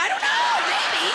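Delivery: very high, loud mock-helpless answer; exasperated squeal.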